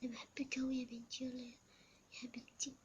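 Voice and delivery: normal talking voice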